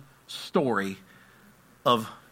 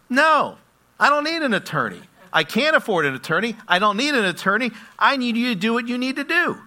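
higher, louder, rushed; insistent lady; 9 semitones higher, 5 dB louder than usual. Retold or imitated. The Spanish-speaking lady insisting to the judge, high and fast. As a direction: refuse the help, high and earnest.

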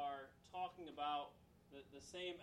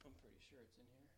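very soft, quick aside; murmured note-hunting; muttering to self; 3 semitones lower, 15 dB quieter, faster than usual. Real, not acted. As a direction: think aloud under your breath while searching. Searching notes, talking to himself.

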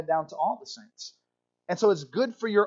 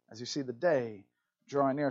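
quiet lower repeat of "the day drawing near"; soft echo; 6 semitones lower, 7 dB quieter than usual. Shown as a demonstration. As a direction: let it fall away softly, like an echo. Echoes the verse, much softer.

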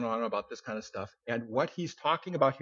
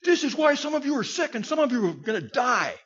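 much higher and louder, scolding; stern rebuke; 10 semitones higher, 4 dB louder than usual. Retold or imitated. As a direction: come down hard on the church. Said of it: "he comes down on, really hard on them".

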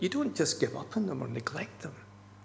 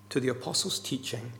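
ordinary delivery

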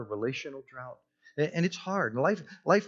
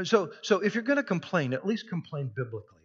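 ordinary talk

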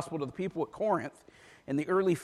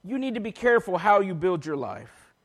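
ordinary talk

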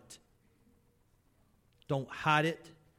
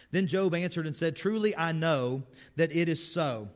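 raised, declaiming scripture voice; scripture reading; 4 semitones higher, 5 dB louder than usual. Acted. Preacher reading Job's reply to his friends, declaiming. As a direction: read it out like a preacher proclaiming.

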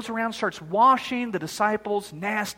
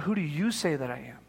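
Jesus asking quietly; 5 semitones lower, 7 dB quieter than usual. Acted. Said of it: "then Jesus says to them".

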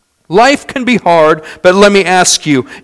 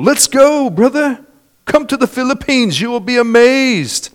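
much higher, his cheerful, inviting way of talking; Pastor John's voice; 9 semitones higher than usual. Retold or imitated. Preacher imitating his late friend Pastor John's invitation, much higher and inviting. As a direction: invite like the visiting pastor would. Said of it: "That's how he talked".

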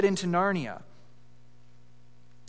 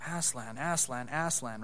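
Aslan, Aslan, Aslan echoed back softer, each one falling; soft repeat; 5 semitones lower, 9 dB quieter than usual. Acted. Jill repeating the call after Eustace, softly. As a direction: echo the name quietly like a follower. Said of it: "repeated Jill".

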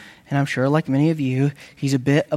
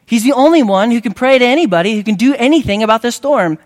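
loud, fast and higher; urgent pulpit push; preaching at full force; 6 semitones higher, 7 dB louder, faster than usual. Real, not acted. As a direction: drive the point home, fast and loud. Preacher presses the point hard.

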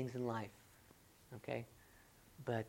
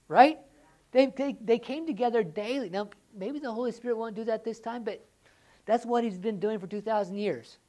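raised, loud preaching that leans hard on daily; fired-up preaching; 8 semitones higher, 9 dB louder than usual. Acted. Pastor urging the congregation to meet daily, loud fired-up preaching. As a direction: preach it out full force to the room.

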